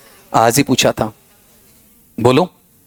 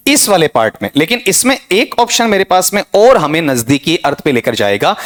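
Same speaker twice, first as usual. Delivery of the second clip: ordinary delivery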